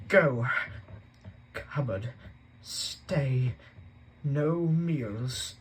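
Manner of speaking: loud, halting words barely forced out; choking with anger. Acted. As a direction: spit out single words too furious to speak. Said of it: "so angry he could hardly speak".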